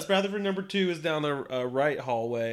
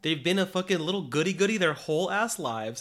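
ordinary talk, nothing marked

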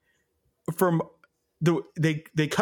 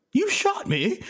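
much higher mock whine of a wounded henchman; mocking quote. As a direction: squeak it out like a hammy pirate. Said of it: "And then he goes".

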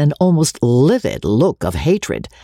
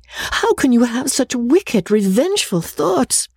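shocked reproach; 9 semitones higher than usual. Acted. Amelia reproaching Rebecca.

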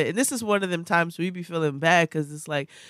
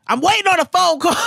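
higher, louder mock yell from mom; imitating mom's scream; 9 semitones higher, 5 dB louder than usual. Mother yelling at her kid to get off the phone, mock yell. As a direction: belt it like a fed-up mother. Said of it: "your mother screams at you".

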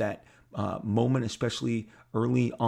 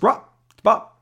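much higher, loud brisk editor greeting; Bob Harris voice; 12 semitones higher, 11 dB louder than usual. Retold or imitated. Editor phoning the artist, high loud greeting. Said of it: "That's how he talked".

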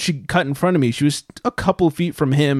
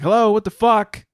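high, raised angry shouting; angry yelling; 7 semitones higher than usual. Retold or imitated. Shouting at the woman who cut in line, angry. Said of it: "I started getting angry".

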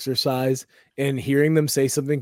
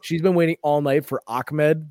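ordinary talk, nothing marked

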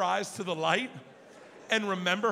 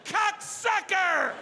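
high, slow, drawn-out curse; furious curse; 9 semitones higher, 3 dB louder, much slower than usual. Retold or imitated. Dad yanking a stuck door, high drawn-out curse. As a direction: curse the door that won't open.

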